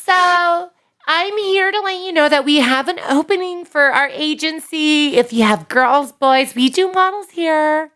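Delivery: very high put-on girl voice; agency caller in a convincing female falsetto; Michelle from Barbizon. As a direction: pitch it up into a girly phone-pitch voice. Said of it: "it's like the girl voice that I do".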